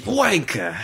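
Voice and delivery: high British road-rage yelp; British accent. Acted. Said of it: "you just go into a British accent".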